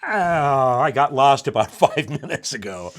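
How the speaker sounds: loud mock wail of confusion; brain giving up. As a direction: groan it out, comically lost. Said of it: "your brain's going".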